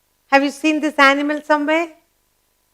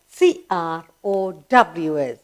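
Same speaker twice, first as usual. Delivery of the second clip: usual speaking voice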